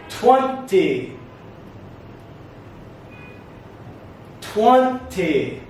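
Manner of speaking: "Twenty" slowly, lifting the first syllable; stress on first syllable. Shown as a demonstration. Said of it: "The stress goes up".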